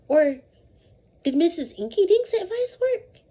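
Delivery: ordinary delivery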